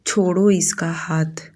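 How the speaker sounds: slow, understated irritated order; a touch of annoyance, held back; mild irritation. A narrator's reading. Sujata tells Yug to release Mannat, quietly irritated. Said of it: "Sujata जी चिढ़ते हुए बोली".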